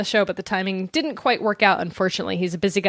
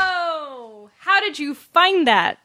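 amazed; 7 semitones higher, much slower than usual. Real, not acted. Amazed at co-host's find.

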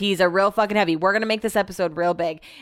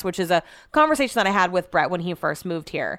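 usual speaking voice

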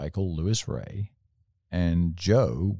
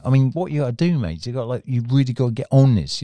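excitable London patter, higher and louder; London accent impression; 6 semitones higher, 7 dB louder than usual. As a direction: imitate a Londoner urging his mate on. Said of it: "he sounded a bit like Russell Brand".

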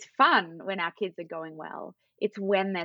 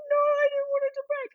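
put-on much higher sulky child voice; upset toddler voice; 3 dB louder than usual. Mum retells her son's reply in a high child voice. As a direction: sound like a hurt two-year-old.

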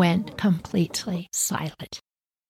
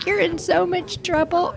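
high scolding voice; 10 semitones higher than usual. Retold or imitated. Someone scolding the kids.

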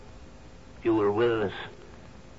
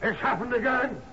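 alarmed calling out; 9 semitones higher than usual. Acted. Father calling for the captain, alarmed high shouts. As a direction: call across the island in panic.

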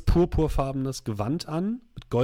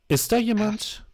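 higher, searching call; sorceress calling out; 8 semitones higher than usual. Acted. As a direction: call into the empty room. Sorceress calls out.

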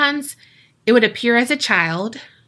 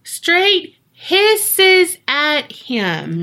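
very slow, higher, stretched words; slow emphatic storytelling; drawn-out emphasis; 7 semitones higher, much slower than usual. A narrator's reading. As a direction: draw out every word for dramatic weight. Teller dramatizes the hissing.

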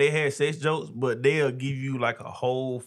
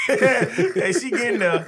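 slow words through real laughter; laughing; 10 semitones higher, 4 dB louder, much slower than usual. Real, not acted. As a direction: say it while cracking up. Cracking up while teasing.

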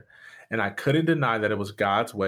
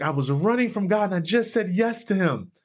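excited; 9 semitones higher than usual. Retold or imitated. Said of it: "I was like".

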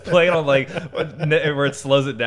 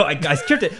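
ordinary delivery

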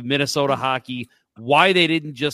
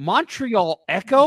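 higher, louder and slow, drawing out "Montreal Echo?"; incredulous; 7 semitones higher, 6 dB louder, slower than usual. Real, not acted. Hearing the new Montreal name, incredulous and drawn out. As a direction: repeat the name as if it's a joke.

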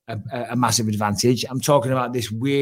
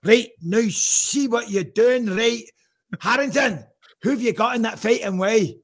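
higher, hammed-up Scottish brogue; mock Scottish accent; 9 semitones higher than usual. Host quizzes Harrington in a mock Scottish accent. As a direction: keep playing the Scottish host. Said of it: "what's going on with the accent".

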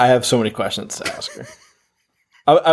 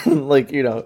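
normal talking voice

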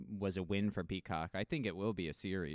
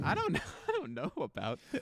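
laughing quote; 5 semitones higher than usual. Retold or imitated. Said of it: "It's like".

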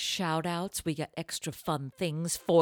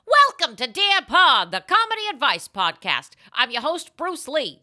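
announcer intro; 8 semitones higher, 8 dB louder than usual. Acted. Host opens the podcast in a booming announcer voice. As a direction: open the show like a big announcer.

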